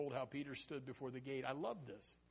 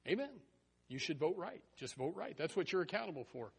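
usual speaking voice

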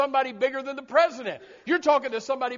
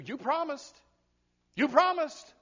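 put-on kids' plea; 4 semitones higher than usual. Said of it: "your kids that say".

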